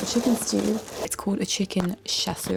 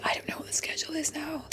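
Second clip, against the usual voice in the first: quick, breathless whisper; whispering to herself about the baking schedule; whispered worry; 8 semitones higher, 9 dB quieter, faster than usual. Retold or imitated. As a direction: hush it out like a confused aside. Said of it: "and I'm like".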